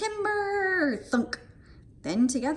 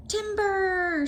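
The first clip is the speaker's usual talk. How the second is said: shout; 9 semitones higher than usual. A narrator's reading. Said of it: "shouted Max".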